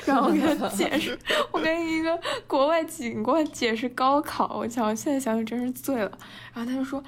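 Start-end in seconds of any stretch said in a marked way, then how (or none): 0.0-4.6 real laughter breaking through, higher and louder; laughing so hard the words wobble; laughing while talking. Real, not acted. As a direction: talk through giggles at how silly it was. Laughing at the absurd memory.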